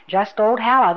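ordinary delivery

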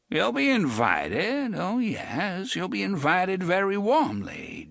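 put-on high, slow, sly old man's voice; a wry old drifter, amused; old gunman Jonas. A narrator's reading. Jonas asking Reynolds about the brats, sly and high.

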